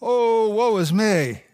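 anguished cry, high at first then settling; a prophet overwhelmed, lamenting his sin; crying out in woe. A narrator's reading. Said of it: "And he cries out".

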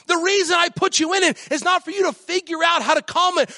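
high, ringing preacher's cry; preaching at full force. Real, not acted. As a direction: lift the church up with every line.

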